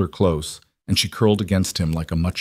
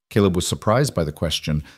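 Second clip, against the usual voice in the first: ordinary delivery, nothing marked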